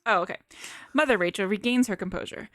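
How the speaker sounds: normal talking voice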